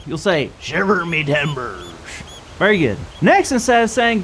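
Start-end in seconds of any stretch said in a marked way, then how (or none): 0.6-1.8 low, slow growling pirate voice. Shown as a demonstration. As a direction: rumble it like a salty old pirate. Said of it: "lower your voice to, uh, like a, like a growl".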